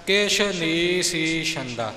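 ਕਿਛ ਨੀ ਸੀ ਛੰਦਾ slowly, a small pause between each word; words kept apart. Shown as a demonstration. Teacher showing the student to read the words separately, slow and spaced. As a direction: separate ਨੀ and ਸੀ clearly, don't run them together. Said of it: "ਵੱਖ-ਵੱਖ ਪਾਠ ਕਰਨਾ ਜੀ".

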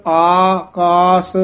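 ਆਕਾਸ stretched very slowly, long aa vowels; drawn-out word. Shown as a demonstration. Teacher models ਆਕਾਸ slowly for students. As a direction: draw out ਆਕਾਸ as one word. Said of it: "ਇਹ ਵੱਖਰਾ ਨਹੀਂ ਬੋਲਣਾ".

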